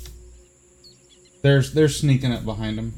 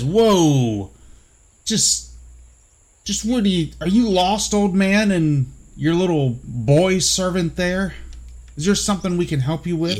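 guard voice; 4 semitones higher, 3 dB louder than usual. Acted. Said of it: "One of them steps forward and says".

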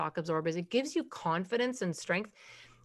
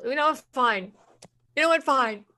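exasperated giving up; 4 semitones higher, 5 dB louder than usual. Retold or imitated. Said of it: "we go into the pa-, parental".

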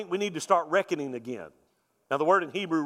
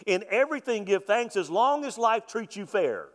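mock misquote; 8 semitones higher, 4 dB louder than usual. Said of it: "what Paul doesn't say".

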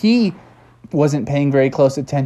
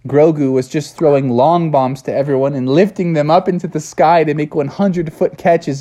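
plain reading voice

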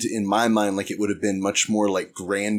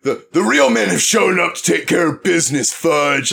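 loud, high, cocky put-down; swaggering attitude; 8 semitones higher, 7 dB louder than usual. Acted. Acting out a man taking charge over Fudge, loud swagger. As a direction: throw it in Fudge's face at full volume. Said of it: "that's the attitude that he takes on".

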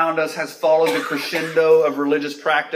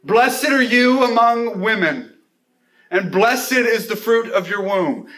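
loud cry; 6 semitones higher, 4 dB louder than usual. A narrator's reading. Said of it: "exclaimed with a loud cry".

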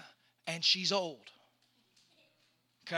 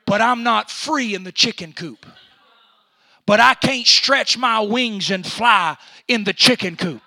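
building sermon; 12 dB louder than usual. Acted. Preaching builds.

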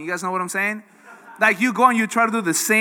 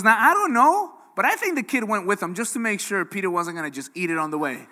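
ordinary talk

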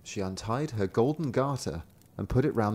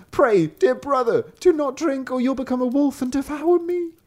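high, louder pleading sister voice; 5 dB louder than usual. A narrator's reading. Sister crying out to her brother, high and pleading. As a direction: cry out to stop him. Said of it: "The sister cried out".

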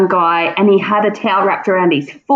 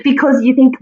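usual speaking voice

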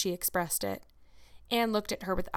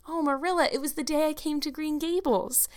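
put-on higher, heartfelt girlish exclamation; excited reveal; 8 semitones higher than usual. A narrator's reading. Anne tells Marilla of her anniversary, excitedly. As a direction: gush it in a raised voice.

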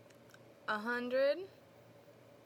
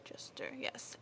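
whisper; 13 dB quieter, slower than usual. Real, not acted. Aside confirming the name.

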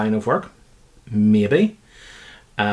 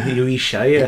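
put-on quick playful Scottish accent; 2 semitones higher, 4 dB louder, slower than usual. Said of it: "The accent would have been".

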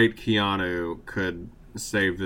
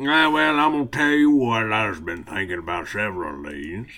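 put-on Southern accent. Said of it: "Southern accent segment".

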